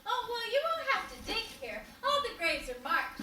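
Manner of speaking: ordinary delivery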